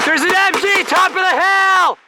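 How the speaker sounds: battlefield shout. Acted. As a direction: yell it across the field. Calling out enemy gun.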